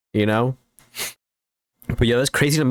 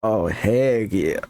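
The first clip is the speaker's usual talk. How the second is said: normal talking voice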